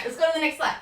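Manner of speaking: ordinary delivery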